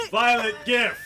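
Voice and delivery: loud, laughing call of the spell; laughing shout. Retold or imitated. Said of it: "and you go".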